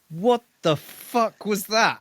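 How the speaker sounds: high, slow, incredulous; mock startled what-the; shocked outburst. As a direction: stretch it out in disbelief. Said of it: "And he's like".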